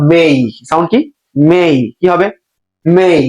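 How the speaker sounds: MAY with a clear gliding "ay", "mei"; AY sound. Shown as a demonstration. Teacher drilling the correct sound, MAY as "mei". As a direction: finish MAY on a full i-glide. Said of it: "এ এর মতো".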